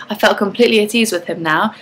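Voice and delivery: ordinary delivery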